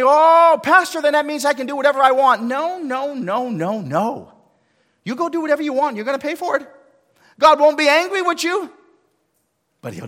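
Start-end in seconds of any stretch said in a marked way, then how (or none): 0.0-2.4 loud, high, fast whiny excuse; hammed-up mock congregant; mock excuse-maker. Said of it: "some people say to me". 2.4-8.7 much higher and louder preaching; preaching at full force. Acted. Preaching peak, loud and high.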